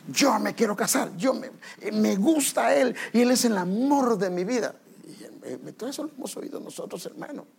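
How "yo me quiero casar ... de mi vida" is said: higher-pitched lovestruck insistence; mock insistent protest. Child answering parent about marrying, mock insistent protest.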